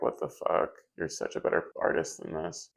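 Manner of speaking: whispered mock dismay. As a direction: whisper it in disbelief. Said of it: "I'm gonna watch this back and be like".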